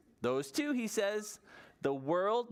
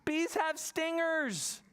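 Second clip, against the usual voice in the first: put-on scared child voice; 12 semitones higher, slower than usual. Kaya fears bees.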